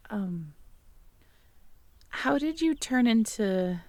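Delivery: plain reading voice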